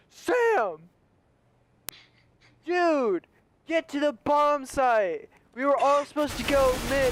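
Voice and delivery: high-pitched yell at normal volume; held-back frantic gamer yell; mock scream. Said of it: "At the top of my lungs".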